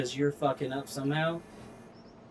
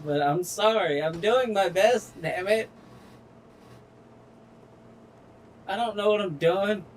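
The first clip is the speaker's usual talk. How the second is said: flustered outburst; 4 semitones higher, 3 dB louder than usual. Real, not acted. Streamer to viewers, flustered and raised. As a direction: pitch jumps up, louder, exasperated. Frustrated gamer apologizing to viewers.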